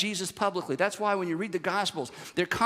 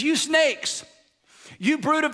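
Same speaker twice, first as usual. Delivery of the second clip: higher, louder scolding cry; Jesus ranting; 7 semitones higher, 4 dB louder, slower than usual. Retold or imitated. Preacher voicing Jesus scolding the leaders, angry. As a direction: hurl the insult at them. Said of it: "He says".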